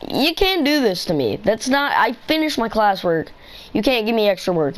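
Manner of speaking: high-pitched retold outrage; indignant protest. Student objecting to extra math packets, indignant protest. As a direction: replay your objection, pitch jumping up.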